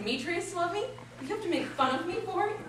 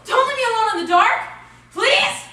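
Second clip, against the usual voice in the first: loud and much higher, pleading; desperate cry; 9 semitones higher, 14 dB louder than usual. Acted. Helena screaming after Demetrius as he leaves her. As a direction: scream after him in panic.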